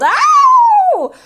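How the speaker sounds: very high, loud drawn-out yelp; pained yell. A narrator's reading. Grandma swallowing the awful medicine, piercing yell. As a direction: shriek in sudden pain. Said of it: "Grandma yelled".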